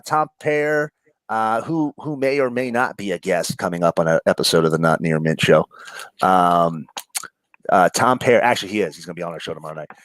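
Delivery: usual speaking voice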